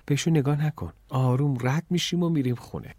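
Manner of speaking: ordinary delivery, nothing marked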